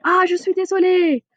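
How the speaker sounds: high "Ah", sorry for stepping on a foot; sincere apology. Shown as a demonstration. Teacher acts a real sorry to a stranger, high.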